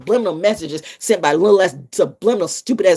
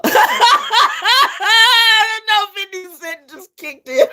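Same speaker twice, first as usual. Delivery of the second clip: much higher, laughing through words; 4 dB louder than usual. Real, not acted. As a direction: crack up mid-sentence. Bursting into laughter.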